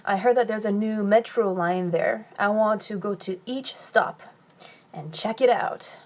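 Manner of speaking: ordinary talk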